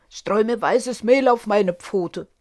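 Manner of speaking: lightly voiced bossy wolf; demanding wolf.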